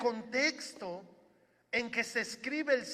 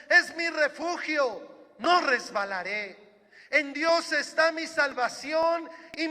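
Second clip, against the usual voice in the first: louder, impassioned psalm reading, slowing on key words; fervent proclamation; 5 semitones higher, 9 dB louder than usual. Acted. Pastor proclaiming trust in God to the congregation, fervent and louder. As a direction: proclaim it like a preacher lifting the crowd.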